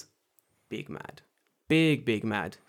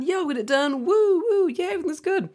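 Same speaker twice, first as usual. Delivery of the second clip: very high, loud mock whooping; hammed-up, frantic everything-is-fine voice; manic fake cheer; 6 dB louder than usual. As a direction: squeal it with forced glee. Manic fake cheer in retold scene.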